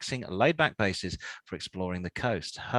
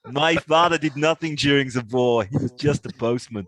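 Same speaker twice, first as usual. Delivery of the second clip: mock Austrian accent; 4 semitones higher, 7 dB louder than usual. Mock Arnie quote.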